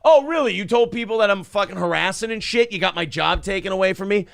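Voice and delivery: high, loud angry challenge; angry confrontation. Retold or imitated. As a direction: square up and confront him. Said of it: "You can just be like".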